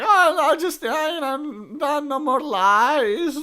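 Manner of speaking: put-on high, melodramatic girl voice; mock dramatic girl. Girl confessing to her father, high hammed-up voice. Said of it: "she's like".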